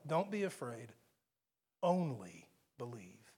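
quiet comfort for a fearful heart; Jesus's gentle words, hushed; tender reassurance. Acted. Quoting Jesus to the father.

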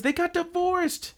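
very high, slow, drawn-out announcement; high slow gossip reveal; breaking the news. Retold or imitated. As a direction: stretch it out in a high squeaky voice. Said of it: "And she says".

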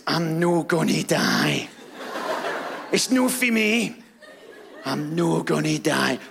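slow, defiant Scottish granny voice. Retold or imitated. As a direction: drag it out like a stubborn old Scottish gran. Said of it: "she's Scottish as well".

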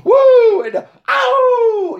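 high, loud, drawn-out "woo" and howl; imitated wrestler catchphrase howls. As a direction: whoop and howl like wrestlers in the ring. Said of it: "Sting and Ric Flair, you know, doing".

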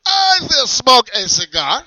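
put-on loud German accent, boastful. Character keeps German voice.

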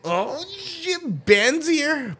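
high, drawn-out delighted cry; excited greeting. Real, not acted. Host spots a friend turning up, excited greeting. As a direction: let the surprise soar up high. Greeting someone who just turned up.

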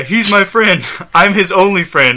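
high, loud acted plea. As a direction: lift the pitch and push it out. Redoing the acted line, higher voice.

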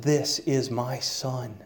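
soft, slow and hushed with pride; proud tender announcement. Retold or imitated. New father showing his son to the family, softly proud. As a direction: present the newborn gently, almost reverent.

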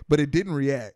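normal talking voice

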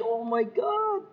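high drawn-out gushing coo. Retold or imitated. Fan of sweet older folks, gushing. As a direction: gush over them, slow and high. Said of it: "I'm like".

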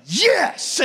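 high, loud, triumphant yes; excited shout. Retold or imitated. Groom telling the audience his joy, excited high yes. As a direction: burst out with a joyful yes. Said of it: "I was like".

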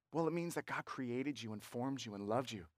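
low, softened, tender answer; gentle explanation. Acted. Gently explaining a verse to reader.

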